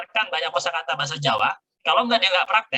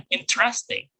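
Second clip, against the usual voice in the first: "Interesting" higher, with native-English stress; native-style stressed interesting; 5 semitones higher, slower than usual. Shown as a demonstration. Teacher shows how natives stress it, native-style emphasis on "Interesting". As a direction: lean on "Interesting" like a native speaker. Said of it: "Kalau native, dia akan ditekan lincahnya".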